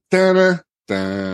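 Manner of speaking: slow, high hummed fanfare; sung fanfare. Retold or imitated. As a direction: hum a little reveal fanfare. Fanfare as door opens.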